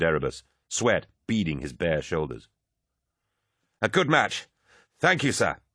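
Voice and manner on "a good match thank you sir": bright-pitched, high salute; high, pleased acknowledgement; exhilarated winner. A narrator's reading. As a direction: thank an opponent, buzzing from victory. Elated winner salutes his opponent.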